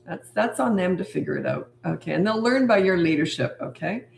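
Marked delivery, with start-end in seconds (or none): none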